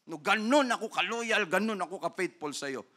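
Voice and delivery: ordinary talk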